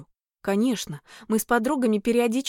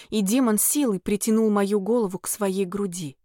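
ordinary delivery

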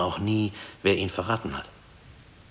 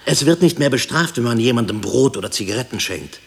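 raised, loud, insistent listing; impassioned, louder and higher declaration; fervent affirmation; 4 semitones higher, 6 dB louder than usual. Acted. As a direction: build it up, insisting each thing still stands. Fervently affirming the world still stands.

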